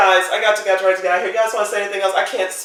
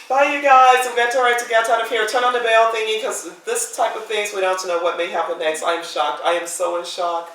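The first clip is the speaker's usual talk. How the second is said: usual speaking voice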